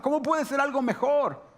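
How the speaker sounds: high-pitched, baffled protest; disbelieving question. Acted. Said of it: "María no podía darse cuenta". (pregunta incrédula, en tono agudo)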